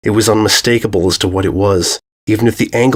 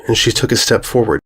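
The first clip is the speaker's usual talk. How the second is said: usual speaking voice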